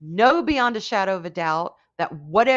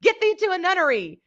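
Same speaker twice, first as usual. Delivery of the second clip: higher, theatrical Shakespeare line; Shakespeare quote; 9 semitones higher than usual. Acted. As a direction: declaim it like a drama major. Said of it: "I read my fair share of Shakespeare".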